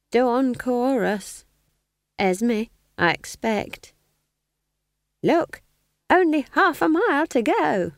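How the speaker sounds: put-on high, slow, sly old woman; sly smiling innocence. A narrator's reading. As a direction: feign innocence through a smile, pitched high, slow. Nanny deflects.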